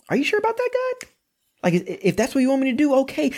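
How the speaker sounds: high, light casual voice talking back to God; a high, easy chat with God; casual questioning prophet. Retold or imitated. Prophet casually questioning God, high and breezy. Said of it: "they'd be like".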